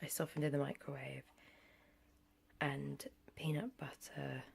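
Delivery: soft whisper over the food. Real, not acted. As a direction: whisper it close to the mic.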